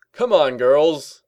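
loud, slow, drawn-out rallying call; cheery call. A narrator's reading. Shining Armor summons the two friends, cheerful loud call. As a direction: call across a room, smiling. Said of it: "called across the room with a smile".

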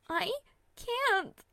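high, frightened refusal; scared refusal. A narrator's reading. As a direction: refuse in fear. Terrified refusal.

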